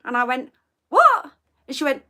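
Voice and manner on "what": much higher, louder "What?"; shocked what. Retold or imitated. Retelling the colleague's rule, shocked. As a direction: bark it out in disbelief. Retold baffled reply to colleague.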